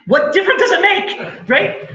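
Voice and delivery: high, emphatic rhetorical question; exasperated. Acted. Speaker challenging people who correct a story, exasperated. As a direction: throw it out in high-pitched exasperation.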